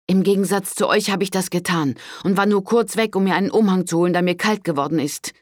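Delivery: put-on defiant young woman. A narrator's reading. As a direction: snap back at the priest. Daughter answers priest defiantly. (trotzige junge Frau)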